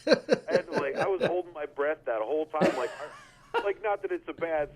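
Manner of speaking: words broken up by laughter; laughing. Real, not acted. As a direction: laugh through the line. Laughing while telling the story.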